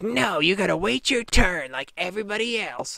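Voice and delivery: bratty Karen impression; mocking Karen. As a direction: sneer it like an entitled customer. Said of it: "And she was like".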